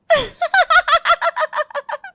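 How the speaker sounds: uncontrollable high-pitched laughing fit; long high giggle. Acted. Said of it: "तू हंस क्यों रही है". (फ़ोन पर बेकाबू खिलखिलाहट)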